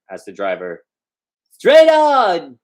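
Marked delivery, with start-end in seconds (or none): 1.5-2.6 high, loud barked command; shouted order. Acted. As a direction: yell it out in desperation. Kovalyev shouts at the driver.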